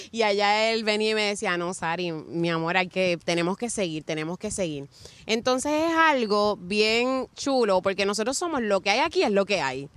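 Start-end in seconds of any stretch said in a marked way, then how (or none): 1.6-4.9 lower, softer steadying voice; retold reassurance. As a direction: imitate a husband gently talking her round. Husband's reassurance retold by wife.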